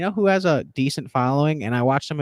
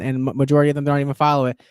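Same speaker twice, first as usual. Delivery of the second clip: normal talking voice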